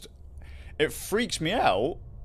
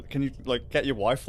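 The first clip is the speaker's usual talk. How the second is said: ordinary talk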